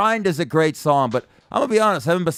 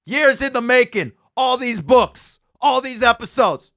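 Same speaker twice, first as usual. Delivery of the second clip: wrestling promo; 7 semitones higher than usual. Pretend. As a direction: hype announcer building to a shout. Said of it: "let me cut a promo for it".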